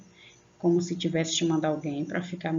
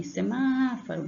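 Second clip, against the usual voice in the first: Semáforo called out, MÁ high and long; chamando alguém; 6 semitones higher than usual. Shown as a demonstration. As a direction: call Semáforo, pulling on the MÁ. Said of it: "Como se você estivesse chamando alguém". (Semáforo chamado, com força no MÁ)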